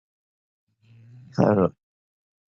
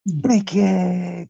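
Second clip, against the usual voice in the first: calls the name high, drawn out; a cheery hello stretched long; excited greeting; 9 semitones higher than usual. Real, not acted. Welcoming a viewer by name on the stream, excited call-out.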